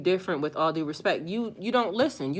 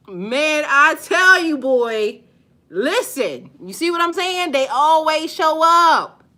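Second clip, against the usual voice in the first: higher and louder, fed-up outburst; exasperated shout; 8 semitones higher, 8 dB louder, slower than usual. Real, not acted. Streamer fed up with trolls showing up, shouting. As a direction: let it burst out, fed up.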